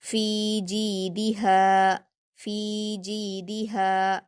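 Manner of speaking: फी जीदिहा chanted slowly, long फी, जी and हा, short दि; stretched madd. Shown as a demonstration. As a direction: hold every long vowel of फी जीदिहा but दि. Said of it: "बाकी तीनों में हरफे मद्दा है तो खींचकर पढ़ेंगे".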